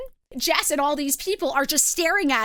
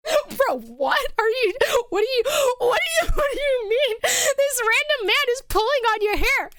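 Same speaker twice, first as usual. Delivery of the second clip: high-pitched, stammering disbelief through laughter; incredulous outburst; 6 semitones higher than usual. Acted. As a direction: react aghast and amused, tripping over the question. Teller's incredulous reaction to hair pull.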